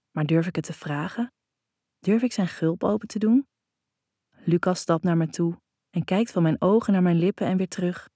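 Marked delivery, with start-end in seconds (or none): none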